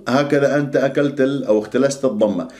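normal talking voice

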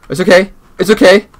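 high loud shaky "it's okay"; panicked reassurance. Real, not acted. Player alone in the dark, panicking. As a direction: talk yourself down in panic.

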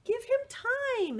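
high gentle voice; high kindly sunshine voice; kind crayon. Acted. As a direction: reassure him sweetly and high. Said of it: "Sunshine said".

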